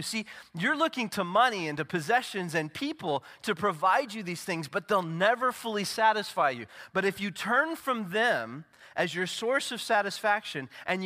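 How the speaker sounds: high, loud, ringing pulpit delivery; preaching at full force. Acted. Pastor to congregation, preaching at full force. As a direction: proclaim every promise to the back row.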